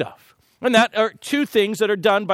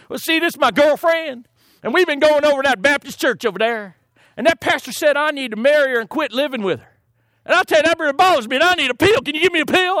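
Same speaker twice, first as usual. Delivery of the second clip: Texan patient; 7 semitones higher, faster than usual. Retold or imitated. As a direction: explain yourself in a hammed-up, high Texan accent. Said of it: "from Texas starts talking like that".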